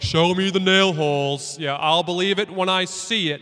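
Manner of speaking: loud, scoffing skeptic's challenge; doubting Thomas. Retold or imitated. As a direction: throw down the dare like a stubborn doubter. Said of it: "and Thomas is like".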